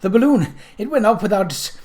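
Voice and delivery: high, loud, urgent outburst; breathless alarm. Acted. Journalist bursts out in alarm to Kate, high and loud. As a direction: rush the news before the cough.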